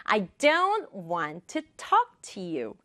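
"don't", "want", "talk" stressed, small words light; rhythmic stress. Shown as a demonstration. Teacher demonstrating rhythm, stress on "don't", "want", "talk". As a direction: punch "don't", "want", "talk" in a slow beat. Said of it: "이렇게 해볼게요".

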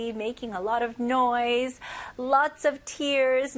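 ordinary talk, nothing marked

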